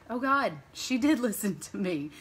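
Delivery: amused, laughing disbelief; real amused laugh mid-line; laughing realization. Real, not acted. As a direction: laugh it out as it dawns on you. Realizing daughter repeated her lesson.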